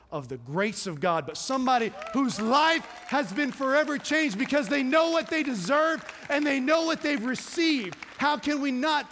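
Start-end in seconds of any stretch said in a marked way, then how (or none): 1.4-9.1 much higher and louder, crying out the climax; rising preacher's cry. Acted. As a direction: lift the congregation with a soaring shout. Sermon climax, voice building up.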